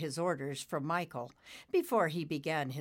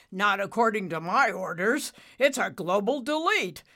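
loud, raised father-cloud voice; emphatic contradiction; 4 semitones higher, 8 dB louder than usual. Acted. Cumulo contradicting Nimbus's orders, emphatic. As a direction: overrule him forcefully.

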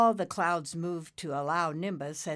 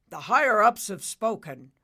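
loud, raised declaration; weighty pronouncement; 4 semitones higher, 10 dB louder than usual. Acted. Cumulo revealing orders to Nimbus, loud pronouncement. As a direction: declare it like a decree.

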